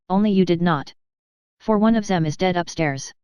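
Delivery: usual speaking voice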